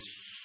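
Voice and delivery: imitated gas burner at low; soft gas hiss. Potter turning on kiln gas, soft imitated hiss. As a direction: hiss softly like gas coming on. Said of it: "it's like this".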